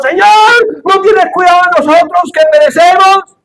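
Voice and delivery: high, loud desperate cry for help; panicked plea. Acted. Disciples begging the sleeping Jesus, high panicked cry. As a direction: plead like men about to drown.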